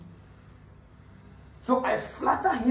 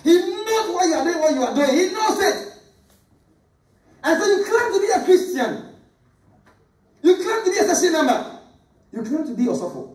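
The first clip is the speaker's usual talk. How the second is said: high, fiery sermon delivery; fervent preaching; 9 semitones higher than usual. Acted. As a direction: preach it out with full conviction. Preaching at full force.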